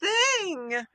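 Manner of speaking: amazed realization. Retold or imitated. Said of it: "And I thought".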